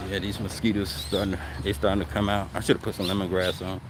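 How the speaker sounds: muffled through a face mask. Real, not acted. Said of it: "if my voice sound muffled".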